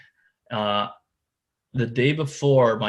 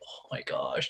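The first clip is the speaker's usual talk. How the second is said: whispered disbelief; 9 dB quieter, slower than usual. Retold or imitated. Co lands another fish.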